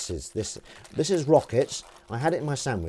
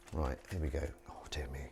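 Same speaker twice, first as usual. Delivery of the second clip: whisper; 9 semitones lower, 13 dB quieter than usual. Real, not acted. Whispered aside while live streaming.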